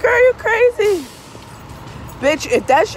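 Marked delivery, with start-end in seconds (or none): 0.0-1.1 mock girlfriend voice. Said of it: "And I like".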